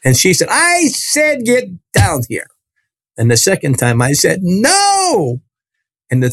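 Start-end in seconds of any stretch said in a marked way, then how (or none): 0.5-2.5 high, drawn-out imitated preacher's order; insistent command. Healer ordering the boy off the balcony, imitated insistent command. 4.4-5.4 high, drawn-out "No"; screamed refusal. Retold or imitated. Boy refusing the healer a second time, retold scream.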